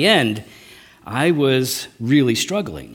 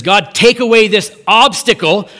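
raised, louder plea to God; pleading cry; 5 semitones higher, 6 dB louder than usual. Retold or imitated. Paul begging God to remove his thorn, raised pleading voice. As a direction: beg God out loud to take it away.

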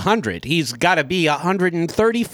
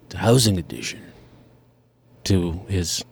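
put-on very old man voice; 6 semitones lower than usual. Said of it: "he's very old, so he talks like".

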